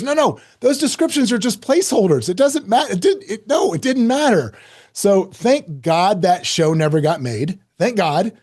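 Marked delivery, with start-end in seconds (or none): 0.0-4.5 high, laughing brush-off; a friend laughing it away; amused reassurance. Retold or imitated. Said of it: "He goes".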